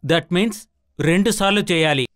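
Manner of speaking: normal talking voice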